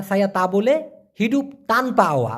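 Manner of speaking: ordinary talk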